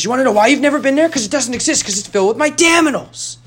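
high, rushed comic tirade; retold mock rant. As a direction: build a ridiculous argument at high pitch. Acted rant in retold argument.

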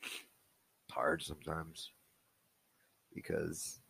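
real choked-up voice, long halting pauses. Real, not acted. Dad overcome while recording his child's birthday message, choked up. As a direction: struggle to get it out, softly. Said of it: "sorry, I'm getting choked up".

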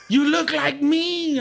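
high slow mock voice. Tesla driver greets lookalike.

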